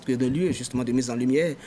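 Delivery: normal talking voice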